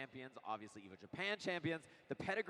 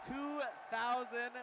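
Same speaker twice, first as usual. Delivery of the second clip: drawn-out hype shout; 10 semitones higher, 4 dB louder, much slower than usual. Real, not acted. Hype introduction continues.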